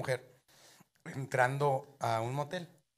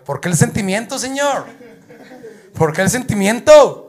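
shouted, higher mock concern; loud mocking question; 7 semitones higher, 14 dB louder than usual. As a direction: taunt him loudly. Moreno burlón pregunta gritando. (burla a gritos)

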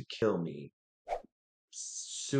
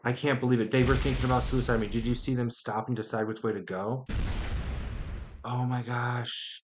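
bubbling over at the lucky break; excited disbelief; 4 semitones higher than usual. Real, not acted. Player watching rivals hand him the win, excited higher chatter. Rival turn unexpectedly goes his way.

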